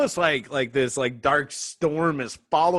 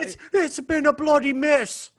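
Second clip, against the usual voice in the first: loud, high-pitched mock British; British accent; 12 semitones higher, 5 dB louder than usual. Co-hosts joking about the bloody mess, hammed-up British accent. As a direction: ham up a British exclamation. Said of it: "say that in a British accent".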